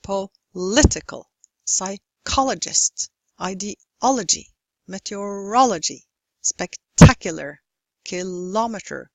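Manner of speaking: poLItical, psyCHOlogist, ideOlogy, meteoROlogy, specTAcular, kiLOmeter, therMOmeter, phoTOgraphy; teacher's list, punching the third syllable from the end; stress third-from-last syllable. Shown as a demonstration. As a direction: split each word before its stressed syllable. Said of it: "stress on the third syllable from the end".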